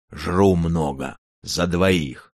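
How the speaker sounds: slow, heavy giant voice; a glutton giant bragging simply; dim slow booming giant. Acted. Giant answers.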